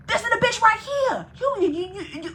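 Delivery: mock furious stutter; pissed girl, high pitched. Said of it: "So the girl was like".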